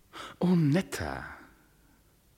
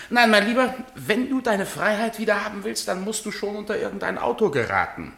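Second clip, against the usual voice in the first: high, loud sneering jibe that ends in a laugh; gloating husband mocking the actor; mocking taunt; 10 semitones higher, 4 dB louder than usual. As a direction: rub his nose in it. Husband taunts rival over contract.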